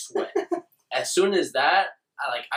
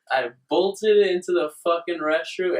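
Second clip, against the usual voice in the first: ordinary talk, nothing marked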